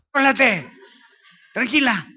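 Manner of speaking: loud high snap to bring her round; sharp shout. Retold or imitated. Slapping a desperate woman.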